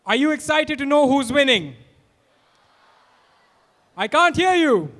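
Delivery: loud and high, rousing the crowd; hyping the crowd. Real, not acted. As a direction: pump up the students.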